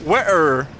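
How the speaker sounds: "wearer" as water with the T dropped, low. Shown as a demonstration. Streamer trying chat's UK pronunciation, "wearer" with no T. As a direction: try "wearer" with no T in the middle. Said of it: "Leave out the T in water".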